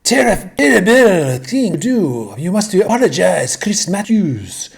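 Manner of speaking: hammy outraged Frenchman; mock French outrage, sacre bleu; French accent. Mocking the French for giving up.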